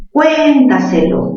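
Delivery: "cuéntaselo" said slowly, stress on cuen; slow stressed word. Shown as a demonstration. Teacher shows students where "cuéntaselo" is stressed. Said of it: "¿Mayor fuerza dónde?".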